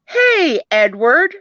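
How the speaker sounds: high, loud hail; calling out. Acted. Jody hails next classmate Edward, high loud call. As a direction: sing out a greeting, starting very high.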